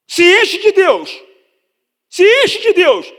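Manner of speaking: repetido mais alto e agudo; enche de Deus. Real, not acted. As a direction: exorte a plenos pulmões. Pregador exortando com força. (pregação exaltada e alta)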